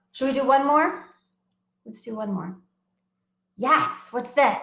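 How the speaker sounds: ordinary delivery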